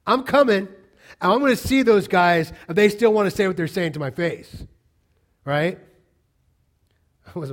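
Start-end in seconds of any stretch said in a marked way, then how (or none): 0.1-4.6 loud, raised-pitch challenge; confrontational. Retold or imitated. Paul answering those trashing him in Corinth, confrontational. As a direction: call them out, forceful and combative.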